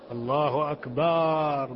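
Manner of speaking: "الله أكبر" said slowly, with the ba of "أكبر" drawn long; stretched ba. Shown as a demonstration. Teacher imitating a mispronounced takbir. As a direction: linger on the ba in "أكبر" until it sounds like "kabar". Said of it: "يمد الباء".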